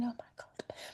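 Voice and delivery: a soft, real choked-up whisper, barely voiced; a very soft whisper, moved by the thought. Real, not acted. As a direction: let the words slip out in a near-silent whisper as tears come. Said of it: "getting all choked up thinking".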